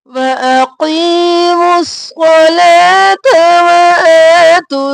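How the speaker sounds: slow, drawn-out melodic recitation; Quran recitation. Shown as a demonstration. Teacher recites the verse for students, slow and melodic. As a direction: chant the verse slowly and fully.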